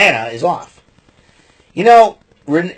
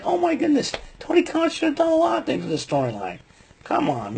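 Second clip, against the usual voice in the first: much higher, exasperated outburst; high-pitched frustrated rant; 8 dB quieter than usual. Real, not acted. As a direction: voice jumps up in disbelief. Exasperated rant about Tony Khan.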